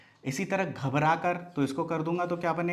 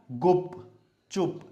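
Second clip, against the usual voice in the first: slow sounding out; 2 semitones higher, much slower than usual. Shown as a demonstration. Teacher modelling the right word for pupils. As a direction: draw out gupchup slowly. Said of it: "क्या बना?".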